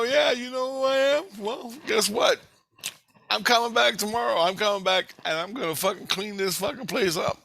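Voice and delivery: high-pitched, heated ultimatum; retold threat. Intruder promises scientist he is coming back, heated threat. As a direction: re-enact a worked-up warning. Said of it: "so I threatened him".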